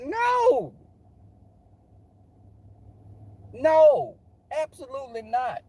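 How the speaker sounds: high, loud comic no; emphatic refusal. Real, not acted. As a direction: shut the idea down with loud outrage. Emphatic refusal of big man in bed.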